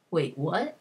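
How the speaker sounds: stunned question, pitch swooping up at the end; double-take at the news, rising sharply; retold disbelief. Logain hears shocking news, retold.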